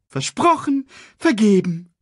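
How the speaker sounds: very high, loud character voice; full-throated proclamation. A narrator's reading. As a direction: keep crowing it out. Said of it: "sang Sasu aus voller Kehle".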